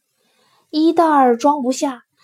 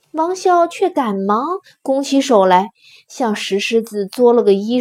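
ordinary delivery

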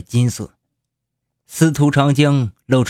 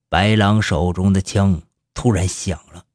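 ordinary delivery, nothing marked

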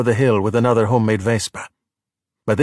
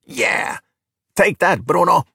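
hollering; 6 semitones higher, slower than usual. A narrator's reading. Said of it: "Alberto hollered".

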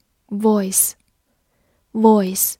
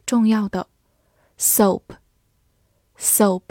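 ordinary delivery

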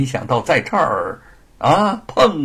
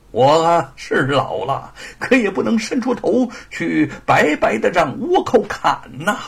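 put-on earnest old man pleading to join; lively old man's plea, swinging higher; lightly voiced old veteran; 6 semitones higher, 3 dB louder than usual. Old veteran begs to join the fight against invaders, lightly acted plea. Old father-in-law character speaks.

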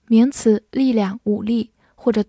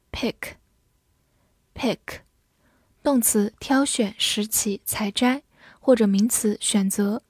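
ordinary delivery

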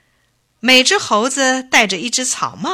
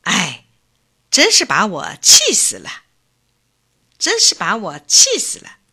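1 sighing exasperation. A narrator's reading. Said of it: "叹了口气说".

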